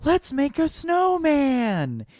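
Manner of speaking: high, slow, stagey call; excited call. Retold or imitated. Actor rallying the kids on stage, high slow call. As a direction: invite the kids in with glee. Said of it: "he goes".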